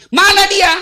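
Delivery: high, loud urgent cry; urgent shout. Acted. Blind man urgently asking where Muhammad is. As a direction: demand an answer.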